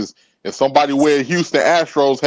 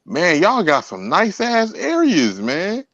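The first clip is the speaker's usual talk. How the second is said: higher, slow, amazed put-on voice; awed tourist voice; 8 semitones higher, slower than usual. Visitor marveling to an LA friend, slow high put-on awe. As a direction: gush about the nice areas, wide-eyed. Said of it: "I'm like".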